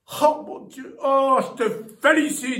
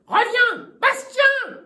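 usual speaking voice

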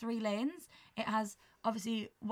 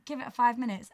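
ordinary talk

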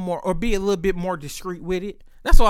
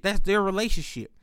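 ordinary delivery

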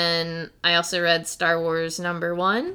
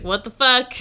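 startled shout, pitched up; shocked; 7 semitones higher, 7 dB louder than usual. Retold or imitated. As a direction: blurt it out in shock. Kid reacts to superhero.

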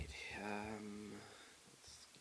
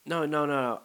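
higher, mock British refusal; bad British accent; 6 semitones higher than usual. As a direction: imitate a British friend objecting. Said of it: "sorry for this horrible accent".

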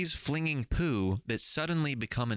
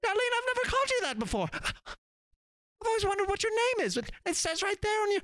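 a monkey just waking up to speech; smart monkey. Acted. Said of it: "you do the monkey".

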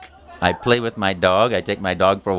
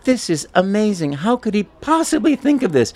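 amazed; 13 semitones higher than usual. Retold or imitated. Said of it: "I thought".

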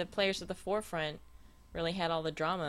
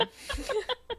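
high laugh that hurts; pained laugh. Real, not acted. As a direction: laugh through real pain. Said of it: "it hurts to laugh".

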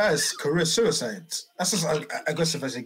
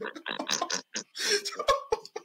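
airy, voiceless high giggle; breathy laugh; 5 dB quieter than usual. Real, not acted. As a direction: wheeze out a giggle.

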